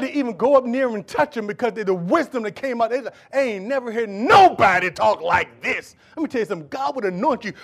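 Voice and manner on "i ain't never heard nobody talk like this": loud, drawn-out astonishment; slow it down and swell with amazement; amazed. Acted. Folks marveling at the wisdom of Jesus, amazed.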